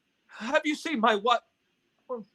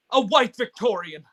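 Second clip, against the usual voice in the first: ordinary delivery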